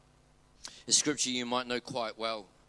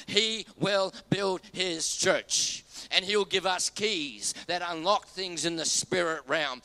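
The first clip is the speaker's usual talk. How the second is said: building sermon climax, louder, emphatic; rising preaching; 4 semitones higher, 4 dB louder than usual. Acted. Pastor driving his point home to the church, fervent preaching. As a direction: preach it with mounting force.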